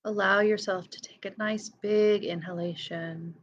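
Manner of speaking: guided meditation. Real, not acted. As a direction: lead each breath gently, leaving silence between phrases. Leads a breathing meditation.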